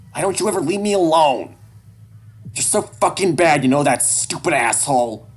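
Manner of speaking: put-on high, loud kid's outburst; high-pitched angry yelling; furious shouting. A narrator's reading. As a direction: lash out at your father at full voice. Child shouting abuse at father.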